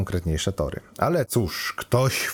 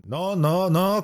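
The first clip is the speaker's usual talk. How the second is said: much higher, quick coaxing repeats; prodding him on; 12 semitones higher, much slower than usual. A narrator's reading. Computer urges the stammering student to continue, prodding. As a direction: egg him on to finish. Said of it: "Kom ciągnął".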